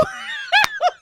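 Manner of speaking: a real squealing burst of laughter; high laughing shriek. Real, not acted. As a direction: let the laugh fly up high.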